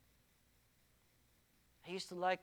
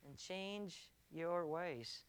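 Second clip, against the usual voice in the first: quiet call to repent; 3 semitones lower, 7 dB quieter than usual. Acted. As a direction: draw out "and change your ways" gently.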